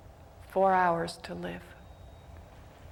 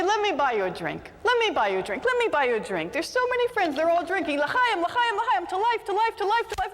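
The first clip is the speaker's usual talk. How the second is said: much higher, louder chorus of drinking friends; a crowd of buddies pushing drinks and cheering; rowdy toasting; 10 semitones higher, 3 dB louder than usual. Acted. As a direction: tavern friends toasting Moshe over and over, excited. Tavern friends all toasting.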